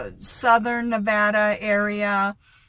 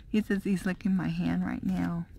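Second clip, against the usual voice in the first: soft whisper; 4 semitones lower, 8 dB quieter, faster than usual. Real, not acted. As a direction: whisper it close to the mic. Unvoiced whisper while filming dog.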